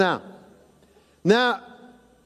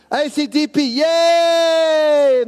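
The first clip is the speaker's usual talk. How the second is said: sarcastic loud high yay; mock cheer; 5 semitones higher, 4 dB louder, much slower than usual. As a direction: cheer it with heavy irony. Mock cheer at tiny vote.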